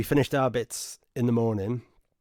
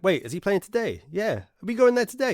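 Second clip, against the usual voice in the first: friend's voice jumps higher and louder, faster at the end; a friend suddenly realising, higher and louder; excited disbelief; 9 semitones higher, 4 dB louder than usual. Retold or imitated. Friend realises they're going to [name], excited and higher. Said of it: "And then went".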